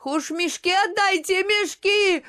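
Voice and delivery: very high, loud pleading scream; desperate cry. A narrator's reading. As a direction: cry out, on the edge of sobs. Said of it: "И рыдания оборвали крик".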